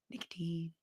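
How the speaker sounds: soft unvoiced whisper; conspiratorial whisper. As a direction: whisper it like a secret. Whispered secret item.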